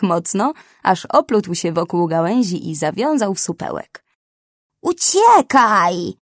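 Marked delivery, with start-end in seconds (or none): 4.8-6.1 loud, drawn-out shout of warning; yell. A narrator's reading. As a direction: scream it to make them run. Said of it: "wrzasnęła Gagaduła".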